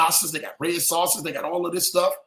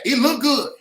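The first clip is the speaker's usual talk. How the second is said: tempted; 5 semitones higher, 4 dB louder than usual. Shown as a demonstration. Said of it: "you just need to say".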